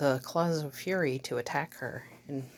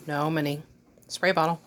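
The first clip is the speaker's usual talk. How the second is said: firm, louder warning to the dog; stern scolding; 7 dB louder than usual. Real, not acted. Scolding dog trying for cat.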